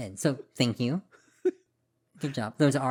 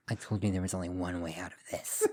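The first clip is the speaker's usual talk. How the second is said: low, quietly menacing murderer line; mock sinister killer; 5 semitones lower, 6 dB quieter than usual. Joking about her co-host's murderous final words, low and hushed.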